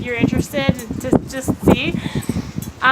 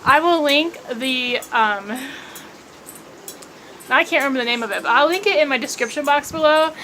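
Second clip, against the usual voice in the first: ordinary talk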